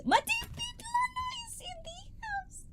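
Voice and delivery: high breathy whisper of a name. Real, not acted. Streamer greeting the live chat, hushed to avoid shouting, whispered. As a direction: whisper it so you don't shout. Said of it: "my voice is going like that because I try not to shout".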